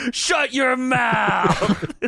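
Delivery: high, drawn-out mock yell; arguing wrestler. Imitating Sid arguing with the front row, drawn-out yell. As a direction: holler it slowly at a heckler. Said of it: "Sid arguing with someone".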